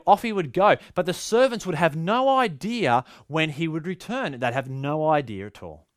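ordinary talk

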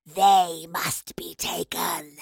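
low, slow, whispery monster rasp; gargling wraith. A narrator's reading. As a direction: rasp it out loud and voiceless, very slowly. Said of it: "the voice gargled".

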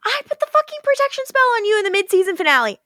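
high, fast, fed-up outburst; exasperated rant. Retold or imitated. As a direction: blurt it out in a fast rush. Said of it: "And he's like".

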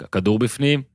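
usual speaking voice

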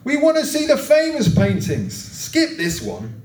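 high-pitched heckle from the back; impatient voice from the crowd; impatient heckler. A narrator's reading. Impatient bidder calls from room's back.

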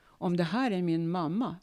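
ordinary delivery

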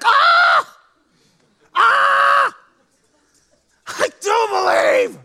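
high, loud, raven-like croaking shout; croaking cry. Retold or imitated. Desperate father crying out to Jesus, croaked shout. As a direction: gasp it out like a crow. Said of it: "to gasp like a crow or like a raven".

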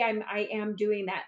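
normal talking voice

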